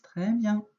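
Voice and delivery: normal talking voice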